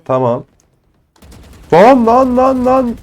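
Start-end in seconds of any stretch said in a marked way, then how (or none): 1.7-3.0 much higher, louder panicked yelps; panicking under fire. Real, not acted. Gamer caught under fire, panicked yelps.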